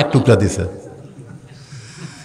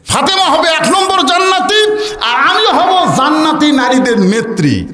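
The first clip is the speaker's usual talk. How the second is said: indignant retort; 12 semitones higher than usual. Acted. Khadija's indignant retort, acted.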